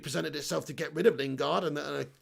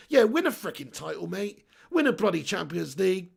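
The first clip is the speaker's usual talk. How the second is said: usual speaking voice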